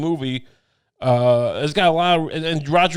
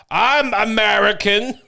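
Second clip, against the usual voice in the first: slow, higher, hammed-up foreigner's American accent; mock American accent; 6 semitones higher, 3 dB louder, slower than usual. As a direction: stretch out a clumsy fake American accent. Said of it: "with a believable American accent".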